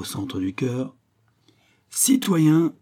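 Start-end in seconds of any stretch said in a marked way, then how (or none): 1.9-2.7 loud rallying call to the crowd; crying out. A narrator's reading. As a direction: call out to the assembly. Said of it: "m'écriai-je".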